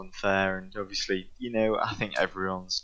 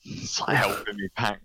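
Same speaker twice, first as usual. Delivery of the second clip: ordinary talk, nothing marked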